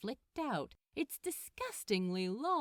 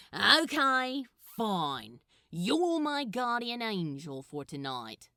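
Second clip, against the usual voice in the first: louder, sardonic demon voice; Crowley, grudging; 5 dB louder than usual. Acted. Crowley grudgingly accepting Gabriel as guardian angel. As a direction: give in with sarcasm.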